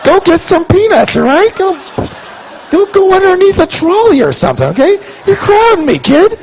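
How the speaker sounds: mock shooing shout. Shooing son away mid-deal.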